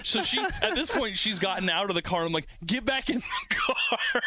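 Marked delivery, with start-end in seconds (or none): 2.6-4.2 mock panicked order. Panicked husband yells at wife.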